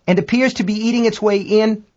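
high-pitched astronaut reporting danger; American astronaut. A narrator's reading. American astronaut reports it eating in, high alarmed voice. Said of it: "American voice".

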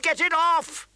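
high, loud scream of pain. Acted. Prince screaming as the wolf mauls his arm. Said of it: "he screamed".

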